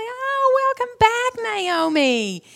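high, bright greeting; excited welcome. Retold or imitated. Naomi's friends greeting her home: excited high welcome. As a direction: sing out the welcome with delight. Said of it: "were excited to see her".

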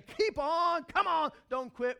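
high, loud keep-on cheer; loud coaching cheer; rallying call. Retold or imitated. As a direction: urge them not to quit. Quoting encouraging friends.

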